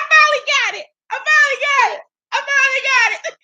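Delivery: high, squeaky celebration through laughter; laughing in triumph. Real, not acted. Host celebrating the finished impression, high laughing voice. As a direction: repeat it breathlessly tickled and proud.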